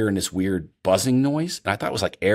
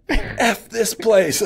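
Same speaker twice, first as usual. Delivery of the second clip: much higher, very slow fed-up moan; fed-up outburst; 14 semitones higher, 3 dB louder, much slower than usual. Retold or imitated. Hunter fed up with the hunt, a high slow moan. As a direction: drag it out like you give up.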